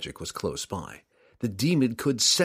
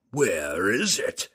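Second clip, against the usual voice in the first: demon lord; slower than usual. Acted.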